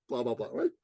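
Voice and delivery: ordinary delivery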